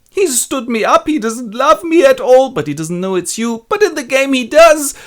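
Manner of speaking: high, loud hammed-up wail; mock crying. Rosalind sobbing on Celia's shoulder, hammed-up high wail. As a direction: wail it like a jilted girl overdoing her tears. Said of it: "Rosalind cries on Celia's shoulder".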